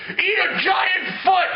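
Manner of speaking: high, drawn-out yell; hammed-up action shout, slow and high; mock battle cry. Giant Doctor Manhattan attacks the villain, mock battle cry.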